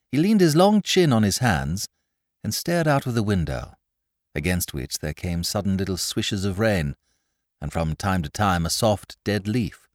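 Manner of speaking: usual speaking voice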